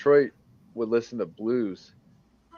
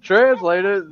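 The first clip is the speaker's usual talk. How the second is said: incredulous; 10 semitones higher, 8 dB louder, slower than usual. Retold or imitated. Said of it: "I'm like".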